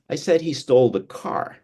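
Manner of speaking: "car" landed firmly as the correction; stress on CAR. Shown as a demonstration. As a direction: put the contrast on "car". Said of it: "changes the meaning".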